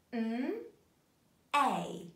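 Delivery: "M-A" said as drawn-out separate sounds; sounding out. Shown as a demonstration. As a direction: sound out "M-A" piece by piece. Teacher sounds out the word.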